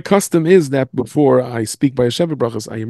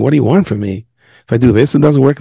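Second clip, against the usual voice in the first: frustrated complaint; 8 dB louder than usual. Acted.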